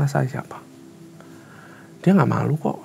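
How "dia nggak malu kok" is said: ordinary delivery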